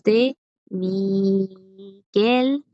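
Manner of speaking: "Miguel" stretched very slowly, syllable by syllable, ending on L; final L. Shown as a demonstration. Teacher shows chicos the final L, "Miguel" drawn right out. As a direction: draw out "Mi-guel" with a clear L. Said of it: "Pues aquí es una L".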